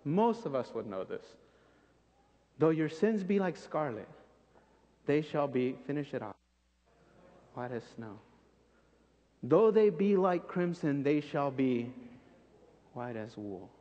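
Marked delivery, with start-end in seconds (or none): 2.6-13.7 soft and low, fading almost to a hush; tender recitation. Acted. Preacher softly recites the familiar verse to the congregation. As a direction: let the promise settle gently.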